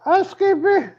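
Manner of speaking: very high, loud falsetto maid voice; mock housekeeper voice. Comic teasing the rock star as a falsetto housekeeper. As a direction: ham up a high-pitched hotel maid. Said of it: "That's my favorite line ever".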